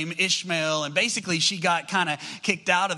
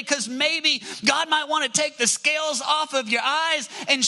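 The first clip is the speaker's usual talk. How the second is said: higher, pleading build toward the challenge; preaching at full force; 8 semitones higher than usual. Acted. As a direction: press them gently then harder. Preacher urging the congregation.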